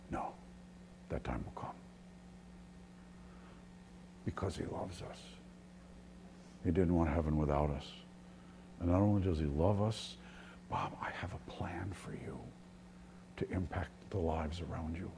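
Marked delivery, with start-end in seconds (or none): 0.1-1.7 low, very soft answer; hushed voice of God holding back; hushed refusal. Acted. Voices God calmly answering the angels. 4.2-5.2 very soft, whispery reassurance; hushed, breath-only delivery; hushed tenderness. Real, not acted. Hushed explanation of God's restraint. 10.7-15.1 God's words in a soft whisper; very quiet, intimate acted voice; hushed calling. Voices God speaking privately to him.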